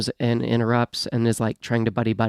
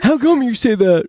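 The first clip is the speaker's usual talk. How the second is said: mock offended customer; 10 semitones higher, 5 dB louder than usual. Joins the mock customer bit.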